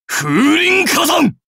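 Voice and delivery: very high, drawn-out shout; slow, full-force battle cry; special attack cry. Acted. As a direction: yell the move's name, stretching each part.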